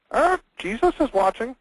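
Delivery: a voice piercing the dark, high and eerie; high-pitched voice. Retold or imitated. Burglar hears a parrot in the dark, high-pitched voice. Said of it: "he heard it again".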